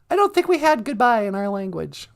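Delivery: very high, small comic voice; high-voiced sidekick. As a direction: pipe up as the host's curious sidekick. Sidekick figure beside host.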